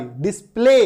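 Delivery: डिस्प्ले said "dis-play", with a clear "ay"; correct pronunciation. Shown as a demonstration. Teacher reads the spelled word correctly, डिस्प्ले as "dis-play". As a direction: finish डिस्प्ले on a long "ay". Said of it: "ए, है ना".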